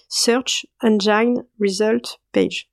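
slow, deliberate English accent on the acronym; hammed-up English accent, said slowly. Shown as a demonstration. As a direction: spell it out proudly in your best English accent. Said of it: "mon magnifique accent anglais".

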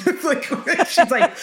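high laugh breaking through the words; amused laughter at the memory. Real, not acted. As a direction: laugh it out while telling the story. Laughing while retelling mom.

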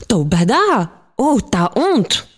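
gasp, then shocked high-swooping cry; shocked girl, words stretched and swooping high; scandalised exclamation. A narrator's reading. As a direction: react with drawn-out, mock-scandalised outrage.

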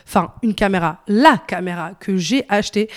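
usual speaking voice

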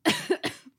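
sudden choking cough; coughing, choking on water; choking on a drink. Real, not acted. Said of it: "Je m'étouffe toute seule".

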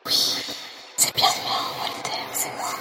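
breathy close whisper; ASMR whisper. Acted. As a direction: whisper right in his ear. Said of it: "T'as perdu ta voix?".

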